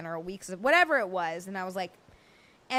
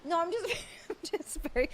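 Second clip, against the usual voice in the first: ordinary talk, nothing marked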